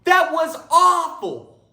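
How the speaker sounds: high, loud, slow outraged yell; furious moviegoer shout. Acted. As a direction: bellow your disgust at the movie. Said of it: "he yelled".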